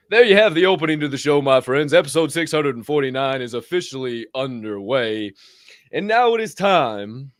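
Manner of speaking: loud, projected host announcement; show announcer. Real, not acted. Host opening the show for listeners, loud announcer delivery. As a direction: launch the episode with big announcer energy.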